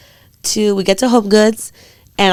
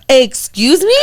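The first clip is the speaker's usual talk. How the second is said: mildly indignant, higher-pitched question; mock indignation; 5 semitones higher, 3 dB louder, slower than usual. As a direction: act affronted, pitch lifting. Acted outrage at dad's claim.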